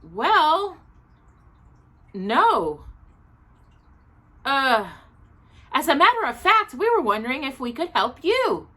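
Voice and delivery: awkward man offers help; embarrassed. Acted. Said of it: "Mister Brown looked rather embarrassed".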